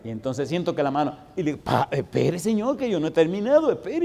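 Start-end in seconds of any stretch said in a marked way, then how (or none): 1.6-4.0 urgent plea. Retold or imitated. Begging the hand to wait.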